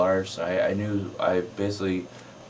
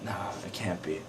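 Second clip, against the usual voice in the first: hushed, whispered disbelief; curious; 11 dB quieter than usual. Retold or imitated. Filmgoers recognizing the actor, curious whisper. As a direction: whisper it like a puzzled onlooker. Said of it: "kinda curiously".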